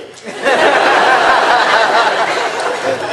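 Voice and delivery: high rising burst of laughter; loud laughter. Real, not acted. Teasing Mike about the drawing, loud laughter. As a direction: break into a laugh.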